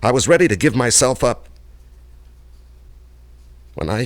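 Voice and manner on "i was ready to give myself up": anguished outburst. Acted. Recounting desperate flight.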